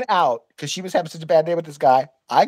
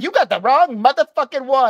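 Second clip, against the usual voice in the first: imitated indignant threat, pitched up; angry yelling woman; 6 semitones higher, 3 dB louder than usual. Clerk warning the rude guest, mock angry yelling. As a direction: play her warning him off, higher than your own voice.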